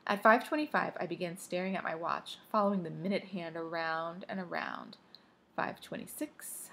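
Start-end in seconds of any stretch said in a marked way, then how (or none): 5.6-6.7 slow, hushed whisper; slow breath-only count; whispered countdown. Acted. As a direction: whisper the time in suspense. Counting tense minutes by watch.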